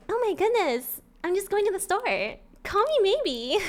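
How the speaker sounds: high, sweet innocent voice; innocent girl-next-door. Shown as a demonstration. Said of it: "One is innocent and one is bratty".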